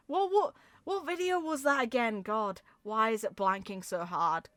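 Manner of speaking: ordinary delivery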